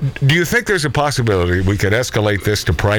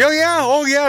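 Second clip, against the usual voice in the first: high-pitched oh yeah; excited high oh yeah; emphatic agreement; 13 semitones higher than usual. Real, not acted. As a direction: squeal the agreement. Agreeing about tearjerkers.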